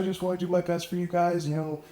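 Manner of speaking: retelling the bass player's tearful plea to the band; imitating crying plea. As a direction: imitate a hurt bandmate pleading through tears. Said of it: "He starts crying".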